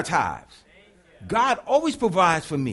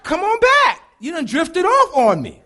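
coaxing God; 10 semitones higher, 6 dB louder than usual. Retold or imitated.

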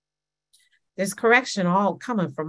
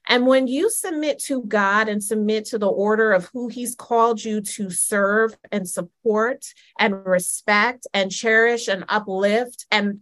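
normal talking voice

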